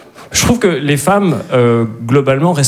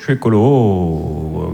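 much lower and very slow; low slow declaration; 8 semitones lower, much slower than usual. Shown as a demonstration. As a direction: drag it out deep. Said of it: "variations sur la même phrase". (beaucoup plus grave et très lent)

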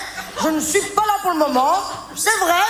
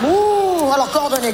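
usual speaking voice

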